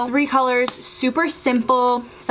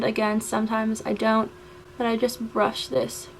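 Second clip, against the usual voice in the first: ordinary delivery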